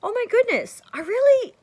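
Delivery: high, loud delighted gush to a stranger; bright street compliment, high and eager. Retold or imitated. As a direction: rave about her dress with excitement. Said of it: "Like, I might just say".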